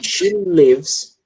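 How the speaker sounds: She lives, with a short English i; «lives» said as «levs»; pronunciation of lives. Shown as a demonstration. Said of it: "A gente pronuncia lives".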